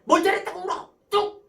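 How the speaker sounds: high, loud mock telling-off ending in a sharp command; scolding mother. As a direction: order a crying child to stop, acted. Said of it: "울면 뭐라 그래요?".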